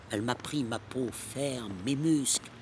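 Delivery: ordinary talk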